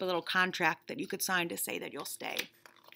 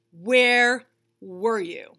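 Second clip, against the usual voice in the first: louder, very slow "where were you"; accusing; 4 semitones higher, 11 dB louder, much slower than usual. Acted. Bunny demanding to know where the reader was, slow and loud. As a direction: drag it out, pointed and accusing.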